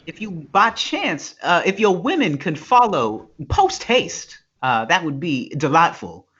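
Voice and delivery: mock-polite evil Southern gentleman; Southern accent. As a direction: make a courtly Southern request. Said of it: "The Southern accent can go evil so quickly".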